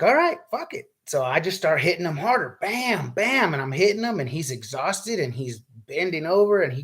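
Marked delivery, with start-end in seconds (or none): 0.0-0.9 high, decisive and done with it; fed-up resolve. Retold or imitated. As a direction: snap into it, no more holding back. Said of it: "And I'm like".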